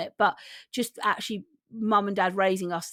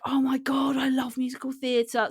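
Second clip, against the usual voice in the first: imitated gushing delight, pitched higher; lightly acted starstruck wonder, higher voice; James, awestruck; 5 semitones higher than usual. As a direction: gush like a newly smitten theatre fan. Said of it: "he was just like".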